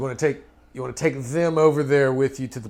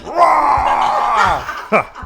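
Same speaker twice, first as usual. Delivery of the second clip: loud shouted rah; hammed-up little war cry; mock battle roar; 11 semitones higher, 8 dB louder than usual.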